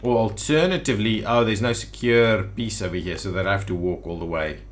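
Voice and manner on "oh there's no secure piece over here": ordinary delivery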